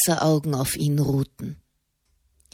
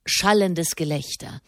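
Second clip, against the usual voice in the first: usual speaking voice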